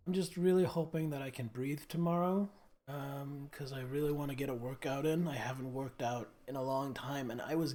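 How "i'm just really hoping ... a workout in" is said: ordinary delivery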